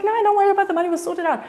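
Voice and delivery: high-pitched lyrical Cape Town lilt; Chris's Cape Town voice. Retold or imitated. Friend waving away money worries, lyrical Cape Town accent. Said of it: "exactly the way he sounds".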